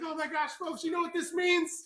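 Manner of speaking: high, hyped-up contest host; breaking-news excitement in a high announcer voice; excited announcer. Acted. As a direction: work the crowd up, pitched high.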